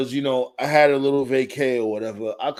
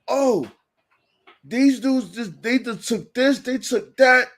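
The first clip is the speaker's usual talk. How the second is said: high-pitched retold rant; agitated, high imitation; mock spazzing; 8 semitones higher, slower than usual. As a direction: imitate a worked-up boss listing accusations. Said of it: "he was spazzing and all that".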